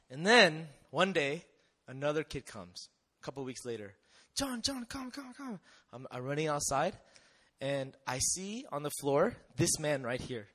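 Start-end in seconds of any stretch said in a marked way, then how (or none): 4.3-5.6 put-on urgent kid calling.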